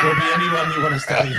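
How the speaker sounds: excited shout. Real, not acted. Laughing outburst about wanting views.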